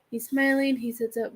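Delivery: normal talking voice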